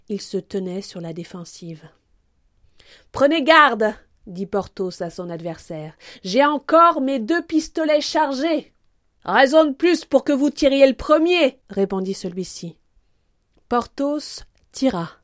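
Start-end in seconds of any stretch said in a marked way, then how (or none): 3.1-3.9 raised, high warning to an enemy; warning call. Acted. Porthos warning his adversary, high and loud. 6.2-11.6 high-pitched battlefield exchange; raised challenge. Acted. Two pistol duellists defying each other, raised high voice. Armed adversaries exchange threats.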